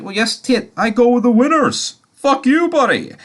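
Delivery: high-pitched, gloating mimicry; cheeky squeaky put-down; mock taunting retort. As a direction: put on a silly high voice, crowing at a loser. Retelling Simon's gloating reply to Tate.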